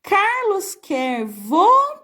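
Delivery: slow reading, last syllable left hanging high; frase para completar. Shown as a demonstration. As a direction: leave the word open for pupils to finish. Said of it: "vocês vão ter que completar". (leitura lenta, final suspenso e alongado)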